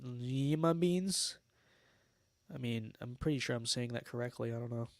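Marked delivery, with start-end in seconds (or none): 0.0-1.4 "Lima" drawn out slowly as LEE-muh; slow, doubtful LEEE-muh beans; questioning pronunciation. Real, not acted. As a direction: stretch the ee in "Lima", testing the word. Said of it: "am I not saying that ni-, right?".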